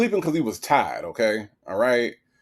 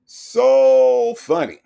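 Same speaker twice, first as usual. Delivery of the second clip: much higher, louder and slow, dripping with sarcasm; sarcastic echo; 11 semitones higher, 6 dB louder, much slower than usual. Retold or imitated. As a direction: throw the words back at her mockingly. Sarcastic echo of her laughter.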